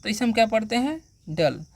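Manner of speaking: ordinary delivery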